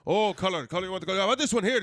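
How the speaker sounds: high loud pushy salesman; mock aggressive vendor; rug seller. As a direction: push the rug on them. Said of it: "He's like".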